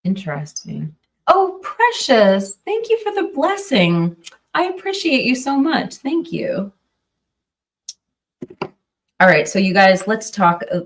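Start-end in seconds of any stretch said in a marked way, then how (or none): 1.3-6.7 high, gushing thank-you. Real, not acted. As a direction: thank them in a much higher, sweeter voice. Thanking a viewer for donation.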